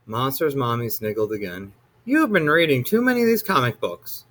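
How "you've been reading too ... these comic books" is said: 1 lightly voiced mother. A narrator's reading. Monster mother scolds son.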